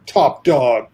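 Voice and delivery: cocky Sammo. Retold or imitated. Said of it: "Sammo being Sammo's going".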